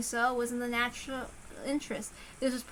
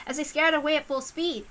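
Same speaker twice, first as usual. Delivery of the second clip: ordinary delivery, nothing marked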